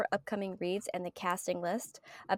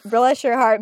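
louder, demonstrated Southern phrase; Southern saying; 3 semitones higher, 12 dB louder than usual. Shown as a demonstration. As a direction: say it loud, like a Southerner. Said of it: "You guys don't speak Southern".

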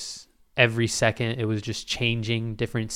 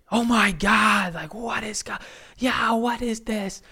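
high, slow, dreamy gasp of wonder; stunned awe; 10 semitones higher, slower than usual. Retold or imitated. Tripping in the car, amazed by the view: high, slow awe. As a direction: marvel at the rainbow road, drawn out.

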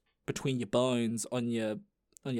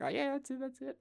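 high, soft, squeaky agreement; loopy approval; 12 semitones higher, 7 dB quieter than usual. Acted. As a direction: trail off in a tiny high voice. Acts out loopy self peering at phone.

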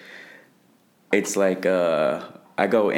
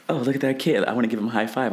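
higher-pitched mock wonder at spotting someone; acted-out distracted thought, pitched up; excited inner thought; 7 semitones higher, faster than usual. Fighter voicing his stray thought about a kid, lightly excited.

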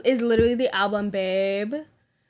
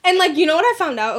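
laughing; 6 semitones higher, 5 dB louder than usual. Real, not acted. Laughing at own joke.

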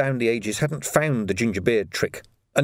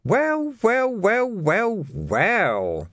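Carcer relishing it, high then sinking; savouring a discovery; gleeful well-wells; 11 semitones higher, much slower than usual. Acted. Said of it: "said Carcer happily".

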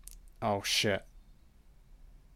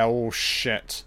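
ordinary delivery

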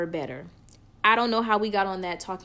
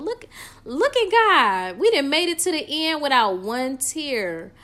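praising God; 7 semitones higher, 6 dB louder than usual. Real, not acted. Triumphant praise after holding tears.

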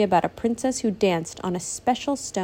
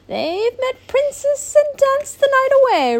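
high, loud, scheming duchess voice; villainous exclamation; 6 dB louder than usual. Acted. Rowena discovering the sisters' secret, high, loud and villainous. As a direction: cry it out like an outraged villain. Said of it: "Rowena exclaimed".

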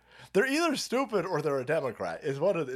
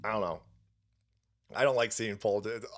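ordinary talk

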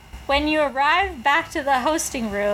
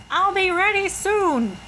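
eerie call; 7 semitones higher than usual. Pretend. Calling out to ghosts, spooky.

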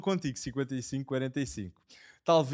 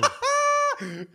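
loud, high burst of laughing; loud laughter; 6 dB louder than usual. Real, not acted. Co-host hears the Liverpool bet, loud high laugh.

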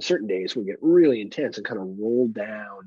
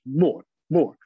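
mock toddler voice; a hint of a toddler demanding more; toddler asking for more; 4 semitones higher, 3 dB louder, much slower than usual. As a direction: beg like a little one for food. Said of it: "She goes".

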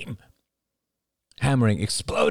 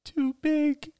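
put-on high tearful little boy; boy sniffling, high and tearful; sniffling child. A narrator's reading. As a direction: sniffle the words out. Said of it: "sniffled Ben".

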